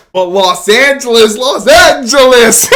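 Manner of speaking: loud, slow, rising hype call; excited shout. Real, not acted. Vlogger cheering his team's title to fans: joyful shout. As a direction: shout the city's name in celebration. Hyping the Dodgers win.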